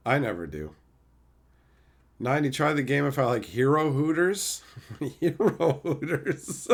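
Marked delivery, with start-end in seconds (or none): 5.0-6.8 slow, higher words breaking into laughs; laughing. Real, not acted. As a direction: say it through helpless laughter. Cracks up at chat's wording.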